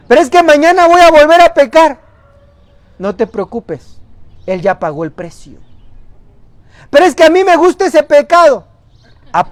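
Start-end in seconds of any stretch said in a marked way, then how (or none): 0.1-2.0 whiny objection. Acted. Imagined believer objects. 6.9-8.7 whiny excuse. Acted. Imagined believer objects.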